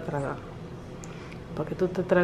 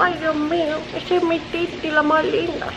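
put-on high, cutesy baby voice; babbling singsong baby, pitched up; adoring baby; 8 semitones higher than usual. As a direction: gush as a besotted little one. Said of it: "Estoy como".

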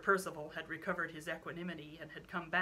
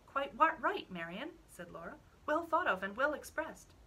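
eager agreement; 7 semitones higher, 5 dB louder than usual. Acted.

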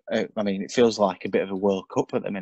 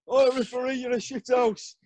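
fan heckling referee; 14 semitones higher than usual. Retold or imitated. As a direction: jeer at the ref like a fan. Imitating fans abusing the referee.

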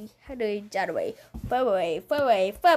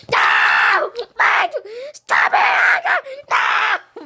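loud, high cries of protest; frustrated yelling; 8 semitones higher, 7 dB louder, slower than usual. Real, not acted. Child losing in a game, yelling loudly in frustration.

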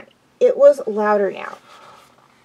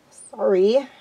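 ordinary delivery, nothing marked

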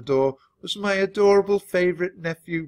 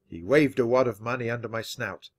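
ordinary delivery

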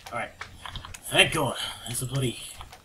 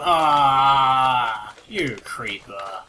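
slow grumble; 9 dB louder, much slower than usual. Real, not acted. Cursing the creeper slowly.

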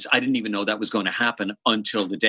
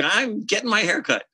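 mock innocent; 12 semitones higher than usual. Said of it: "And I'm like".

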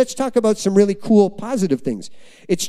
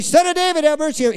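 high-pitched imitated cry for mercy; pleading call; 7 semitones higher than usual. Preacher voicing someone interrupting Jesus for help, pleading. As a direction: call out imploringly, pitched well up.

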